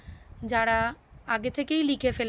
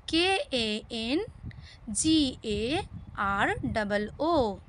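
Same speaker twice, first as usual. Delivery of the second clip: slow spelling out; much slower than usual. Shown as a demonstration. Spells word slowly.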